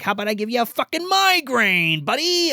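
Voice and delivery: mock the victim like a cruel puppet; hammed-up evil ventriloquist dummy; taunting dummy voice. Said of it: "going into this fit".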